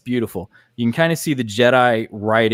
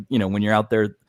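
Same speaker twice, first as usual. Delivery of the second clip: normal talking voice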